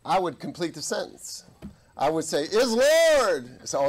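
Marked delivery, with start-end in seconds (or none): 2.4-3.7 high, booming drawn-out holler; chaplain's hollered correction, LORD stretched out; loud proclamation. Retold or imitated. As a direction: belt out LORD, long and high. Acts out loudly completing soldiers' sentence.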